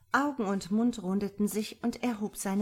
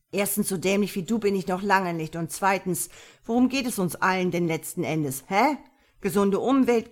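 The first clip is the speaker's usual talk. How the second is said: loud, indignant retort; acted outburst, patience gone; losing his temper; 6 dB louder than usual. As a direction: let him blow his top in a loud tirade. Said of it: "platzte jetzt doch der Kragen".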